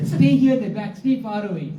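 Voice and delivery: high pitch, real laughter breaking through; laughing self-warning. Real, not acted. Telling oneself to keep away from the guru, laughing. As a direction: keep cracking up while giving the warning.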